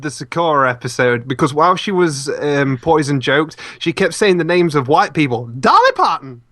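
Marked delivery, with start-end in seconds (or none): 5.5-6.4 sudden acted call-out; mock outburst of a name; shouted outburst. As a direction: blurt the name like a random shout. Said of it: "She shouts out the names".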